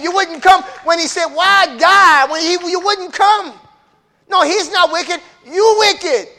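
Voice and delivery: high, loud fiery sermon; preaching at full force. Acted. Preacher calling sinners to judgment, loud and high. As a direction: build the preaching to a roar.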